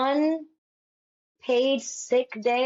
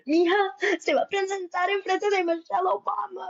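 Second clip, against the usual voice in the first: high, overwhelmed mother voice; mock a tearful mom gushing in Spanish; imitated crying mom; 8 semitones higher, faster than usual. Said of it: "crying. She's like".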